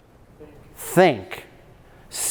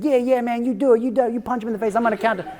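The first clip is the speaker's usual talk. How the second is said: high-pitched hyped-up sidekick; eager little lackey; 7 semitones higher, faster than usual. Retold or imitated. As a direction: egg him on in a high little voice. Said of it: "like this four-foot-nothing lackey".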